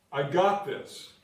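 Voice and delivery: slow, loud, punching the words; a firm, loud "I got this"; emphatic declaration. Acted. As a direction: proclaim it with full conviction. Preacher declaring God's promise.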